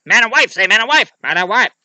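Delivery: high, loud impatient demand; hurrying prince. Acted. Prince speeding up the wedding, high insistent shout. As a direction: push the priest along, loudly. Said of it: "wanted to speed it up".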